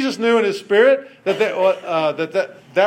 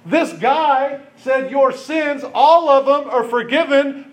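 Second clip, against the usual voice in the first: higher and louder, stunned by "all of them"; incredulous retelling; 7 semitones higher, 3 dB louder, slower than usual. Retold or imitated. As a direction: say it like you can't believe he dared. The teachers' outraged view of it.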